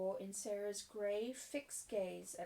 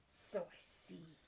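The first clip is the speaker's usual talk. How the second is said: slow, softer, drawn-out disdain; withering; 3 semitones lower, 6 dB quieter, slower than usual. Acted. Mistress answers the girl's excuse, withering. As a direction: let it drop slowly with contempt. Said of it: "said Miss Minchin witheringly".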